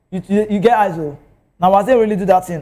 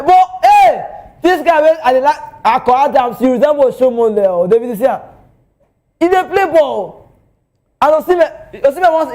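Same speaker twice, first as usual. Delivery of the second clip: high, loud, thrilled shout-out to a favourite player; rising fan excitement, high and loud; excited hype; 6 semitones higher, 4 dB louder than usual. Real, not acted. Hypes his favourite player.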